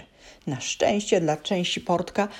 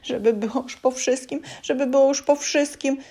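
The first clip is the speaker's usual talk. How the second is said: high, anxious self-reassurance; scared elf repeating a mantra, pitched high; frightened muttering to himself; 8 semitones higher than usual. A narrator's reading. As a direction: chant it to calm his nerves. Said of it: "mówił do siebie Trzęsiportek".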